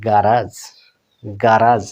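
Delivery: "গ্যারাজ" with a clear r, ending raaj; American pronunciation. Shown as a demonstration. Teacher repeats American garage for learners, r clearly sounded. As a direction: pronounce the r plainly in "গ্যারাজ". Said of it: "American স্টাইলে আর স্পষ্ট উচ্চারণ হয়".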